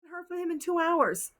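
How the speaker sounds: put-on high, loud whiny girlfriend panic; mock obsessed girlfriend. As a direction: parody a frantic girlfriend. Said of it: "they become obsessed".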